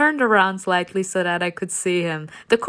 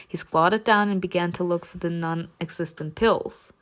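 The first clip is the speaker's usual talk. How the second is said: plain reading voice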